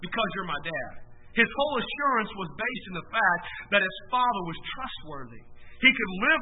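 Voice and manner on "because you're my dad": imitating a calm son, low and soft; remarkable calmness. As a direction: drop down to a quiet, unbothered reply. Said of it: "He responded with a remarkable calmness".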